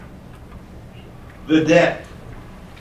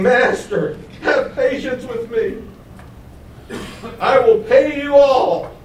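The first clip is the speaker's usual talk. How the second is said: pleading servant; 8 semitones higher, slower than usual. Acted. Servant begging master for mercy.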